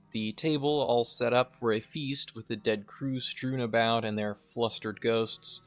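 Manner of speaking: normal talking voice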